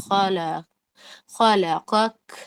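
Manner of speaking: خلقک drawn out slowly, breath released on the ک; hams breath demo. Shown as a demonstration. Teacher demonstrates hams to students, breathy release on kaaf. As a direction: stop on the ک of خلقک with a puff of air. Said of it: "ہمس کے ساتھ رکے".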